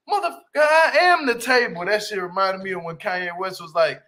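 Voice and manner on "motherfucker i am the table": loud and high, proud mic-drop line; hyped boast quote. Retold or imitated. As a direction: deliver it like a proud brag. Re-quoting the same boast.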